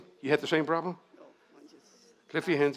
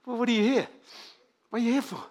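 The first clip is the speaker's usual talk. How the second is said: incredulous; 8 semitones higher, 3 dB louder, faster than usual. Acted. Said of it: "He couldn't get over".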